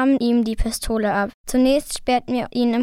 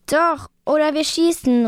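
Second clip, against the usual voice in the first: higher, insistent mock threat; mildly acted raised-voice threat; threatening call; 5 semitones higher than usual. A narrator's reading. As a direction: call it out as a firm warning. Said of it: "riefen wir".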